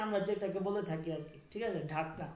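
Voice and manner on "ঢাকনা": normal talking voice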